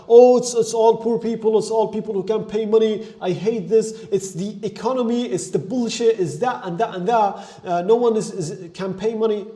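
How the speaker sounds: mock complaining. Client blaming the market, in a mock whiny complaint. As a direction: play the client who blames everyone else.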